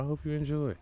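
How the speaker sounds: high sing-song enjoy. Shown as a demonstration. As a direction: lift enjoy into a playful high tune. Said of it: "If it's your fourth time listening".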